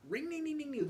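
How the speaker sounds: high whiny two-stroke engine sound; whiny engine noise. Retold or imitated. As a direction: make the dirt bike whine high.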